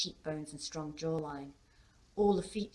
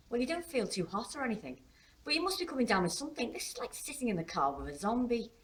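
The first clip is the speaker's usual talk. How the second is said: higher, lively daughter's voice; concerned teenage daughter; 7 semitones higher, faster than usual. A narrator's reading. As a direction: fuss over dad in a higher, quicker voice. Daughter fussing over silent dad.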